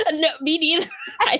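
laughing through the words; giggling as she answers. Real, not acted. Both laughing about [name].

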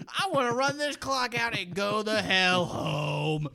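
high, loud hammed-up outburst; mock irate coach. Player imitates head coach who wants to go home, mock irate rant.

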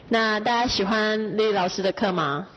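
ordinary delivery

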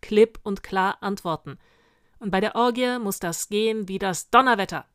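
loud, commanding inspection voice; grim drill sergeant. Acted. Sergeant correcting his witch guard, loud and stern. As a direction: bark instructions along the ranks. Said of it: "musterte grimmig seine Garde".

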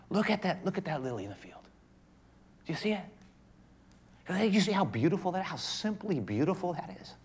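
soft, quiet, wondering voice; hushed awe. Acted. Jesus pointing out a lily, hushed and wondering. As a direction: draw them in quietly to look. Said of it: "I imagine in my mind's eye, Jesus saying".

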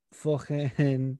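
fucking said Scouse, as fockin with a throaty k; Scouse word. Shown as a demonstration. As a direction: Liverpool fucking, vowel like o.

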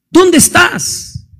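slow, drawn-out pleading call; God calling, slow and searching. Acted. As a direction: stretch the question out as if searching. Preacher calls out God's question.

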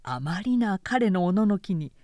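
usual speaking voice

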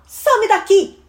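high, loud shooing; irritated sibling snapping; annoyed big sister. Acted. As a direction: order the pest out of the room. Older sister sends child away.

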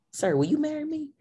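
high-pitched mock proposal; playful proposal. Girlfriend proposing to the winner at dinner, playful excitement. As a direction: pop the question high and playful.

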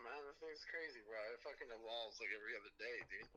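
ordinary talk

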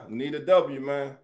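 normal talking voice

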